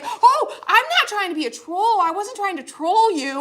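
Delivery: high apologetic backpedal; flustered guy. Retold or imitated. Best Buy worker denying he trolled, high and flustered. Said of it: "And the guy's like".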